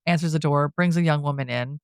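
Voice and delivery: normal talking voice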